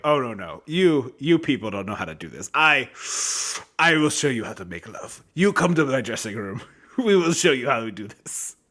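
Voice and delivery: low, hammed-up French lover accent. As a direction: ham up a cheesy French seducer. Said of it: "very stereotypical way of like".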